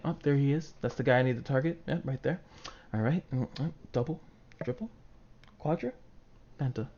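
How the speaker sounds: a focused player calmly narrating a pentakill, softly; calm focused gamer. Retold or imitated. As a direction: stay cool and quietly count the kills. Calm player during a teamfight.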